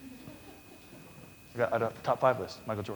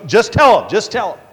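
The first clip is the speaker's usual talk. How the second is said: louder, higher insistence; insisting; 8 semitones higher, 11 dB louder than usual. Retold or imitated. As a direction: press it firmly. Carver insists in story.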